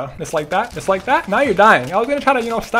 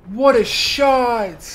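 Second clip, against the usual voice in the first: slow admiring drawl; much slower than usual. Acted. Gamer marvelling at the shot, dragged out slowly. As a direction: stretch it out, slow and awed.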